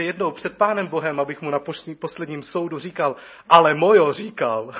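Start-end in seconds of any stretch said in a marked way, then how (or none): 3.5-4.7 loud, laughing mock plea; hammed-up excuse at the last judgment, much louder. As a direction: blurt it out like a caught schoolboy. Pleading excuse before God's judgment.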